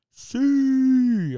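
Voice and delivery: a high, loud imitated goal yell of "see"; mock celebration shout. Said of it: "I'm just sitting there yelling, screaming".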